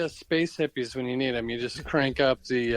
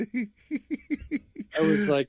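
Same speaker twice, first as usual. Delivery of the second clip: laughing; 8 semitones higher, much slower than usual. Real, not acted. Laughing while retelling.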